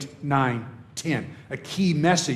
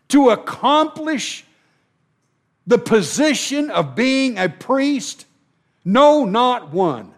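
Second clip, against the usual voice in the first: fiery preaching; 6 semitones higher, 4 dB louder than usual. Acted. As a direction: pound the point home to the congregation. Preaching at full force.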